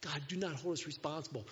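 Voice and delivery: hushed, half-whispered apology; fearful. Acted. Fearful sailors apologise to God, hushed pleading. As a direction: start in a whisper, plead softly. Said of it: "I think they were fearful".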